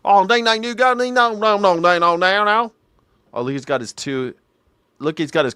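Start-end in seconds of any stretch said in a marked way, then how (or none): 0.1-2.7 higher mock Southern nonsense; mock country babble. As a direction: babble like a country old-timer. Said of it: "guys that talk like this".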